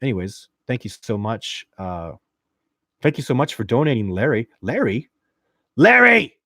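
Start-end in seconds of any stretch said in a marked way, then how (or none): 5.8-6.4 sudden high, loud shout of the name; shouted far above normal talk; calling out. Real, not acted. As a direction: holler it at Larry in disbelief. Calls out donor in mock outrage.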